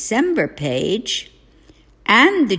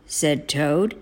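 ordinary delivery

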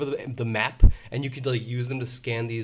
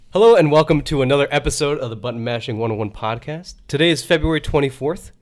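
louder announcer-style welcome; podcast host intro; 6 dB louder than usual. Acted. Opens the podcast episode.

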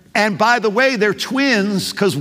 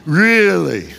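sarcastic really. Acted. Doubting Israel answering God with sarcasm. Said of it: "Can you detect the sarcasm he's using here".

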